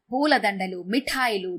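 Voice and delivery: plain reading voice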